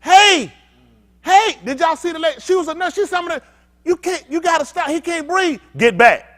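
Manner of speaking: a hint of a frantic nurse begging police; pleading nurse. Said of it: "A healthcare worker standing here telling you".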